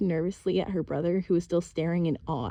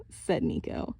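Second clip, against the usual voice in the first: ordinary delivery, nothing marked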